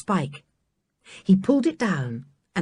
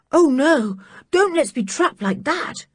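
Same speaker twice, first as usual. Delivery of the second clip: a touch of fear, pitched up high; shuddering dread; 6 semitones higher than usual. Acted. George to the others, a shuddering plea. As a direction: recoil from the thought of being trapped. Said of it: "said George with a shudder".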